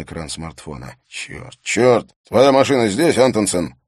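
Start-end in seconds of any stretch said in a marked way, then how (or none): 1.1-1.6 hushed unvoiced curse; whispered curse. Harry reads the screen. 1.6-3.7 loud urgent curse; alarmed shout. Acted. Harry alarmed.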